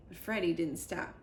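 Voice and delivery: usual speaking voice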